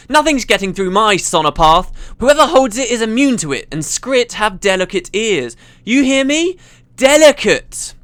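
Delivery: high, loud boastful yelling; shouting. Acted. Engineer bragging about her sonopath to the intruder, shouting. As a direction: yell it, building to a drawn-out last word. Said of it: "the voice shouted again".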